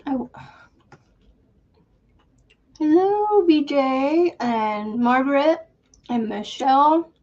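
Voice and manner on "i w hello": normal talking voice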